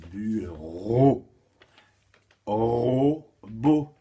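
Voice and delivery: "bureau" then "Robot", syllables drawn apart, RO loud; syllables split. Shown as a demonstration. Teacher shows pupils the linking syllable, "bureau" and "Robot" split with loud RO. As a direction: stretch the shared RO ending "bureau" and starting "Robot". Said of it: "on prend la dernière syllabe d'un mot".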